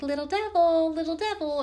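put-on high, sing-song baby talk; cooing mom voice. Mom cooing at her baby in the bath, high sing-song. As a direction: coo it like to a baby.

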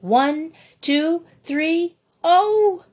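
rising count bursting into a high cry; eager countdown ending in a high yelp; counting down to a leap. Acted. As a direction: count up and launch on the oh.

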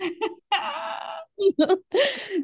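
long, high-pitched cackle of laughter; real squealing laugh; big high laugh. Real, not acted. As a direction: burst out laughing at your own confession. Laughs at own confession.

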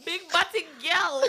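laughing. Real, not acted. Repeating through laughter.

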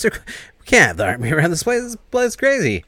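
high, agitated mock objection, a bit loud; acted protest. Arguing against calling the army, agitated protest. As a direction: pitch shooting up in mock alarm.

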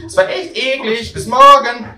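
put-on high, very loud child's farewell; a kid yelling goodbye from afar; shouting child. Boy still yelling while leaving.

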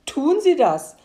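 loud, high, eager shouting, slowing at the end; loud, high excited call, ending slow. Acted. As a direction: cry it out triumphantly, drawing out the last words. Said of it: "rief der Mann".

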